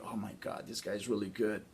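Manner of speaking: very soft, defeated aside; weak response. Acted. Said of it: "there goes a weak response".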